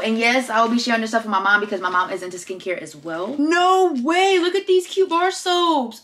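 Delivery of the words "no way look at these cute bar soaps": high, drawn-out excited gushing; delighted amazement. Real, not acted. Vlogger discovering cute soaps, delighted amazement. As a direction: gush in a high, stretched voice.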